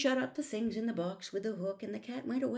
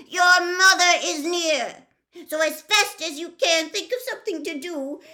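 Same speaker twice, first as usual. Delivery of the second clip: high, loud, fussy fish; upset fish voice; 8 semitones higher, 11 dB louder than usual. Acted. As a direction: play a panicky little fish, loud and high. Fish panicking, shouting.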